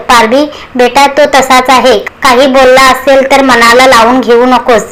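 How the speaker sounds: ordinary delivery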